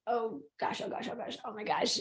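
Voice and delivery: a retold jolt of nerves, spoken barely aloud; anxious hushed gasp. Recalling her dread as the friend reveals news, softly and anxiously. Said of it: "and I was like".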